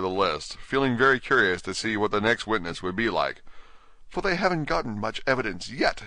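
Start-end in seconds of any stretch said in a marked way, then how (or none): 4.1-6.0 put-on higher, girlish Alice voice; light, high-pitched thinking aloud; talking to herself. A narrator's reading. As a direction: muse it privately in a higher voice. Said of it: "she said to herself".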